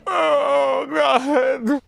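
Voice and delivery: high, loud hammed-up sobbing, stretched very slow; fake crying wail. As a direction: wail the oh my Gods like a staged cry for the camera. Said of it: "muster yourself up to cry and then set up your phone and be like".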